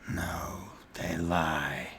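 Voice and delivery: hushed, whispery orc voice; orc child, whispered denial. Acted. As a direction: deny it under your breath as an orc child. GM voices orc child denying rumour.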